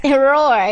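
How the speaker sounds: loud playful roar through a laugh; laughing mock roar. Shown as a demonstration. Interviewer roars back at the child, laughing mock roar. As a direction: roar like a lion, half laughing. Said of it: "Can you roar like a lion?".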